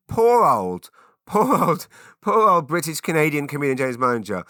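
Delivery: loud, high hammed-up lament; theatrical "poor me" wail; mock pity. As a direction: plead your own case with exaggerated sympathy.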